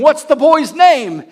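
high, loud, slow question; urgent question. Retold or imitated. Preacher acting out the question about the boy's name, urgent. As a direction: call it out eagerly, stretching each word.

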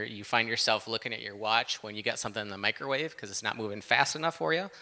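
ordinary delivery

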